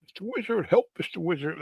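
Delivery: high, light cartoon cry; cartoon turtle calling for help. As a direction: imitate a little turtle pleading, pitched high.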